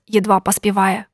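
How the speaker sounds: ordinary delivery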